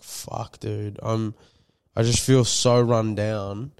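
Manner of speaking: ordinary talk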